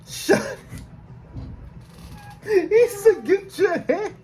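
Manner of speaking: high-pitched laughing shriek, half crying; shrieking laughter. Real, not acted. Shocked laughing at the shooting.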